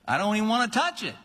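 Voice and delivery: mock recoil, high and fast; disgusted whine. Believer whining against persecution, high and hurried. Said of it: "You go".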